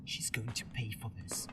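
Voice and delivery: low, soft, unvoiced vow; quiet vengeful whisper; whispered threat. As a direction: promise revenge under your breath. Vows revenge on captor.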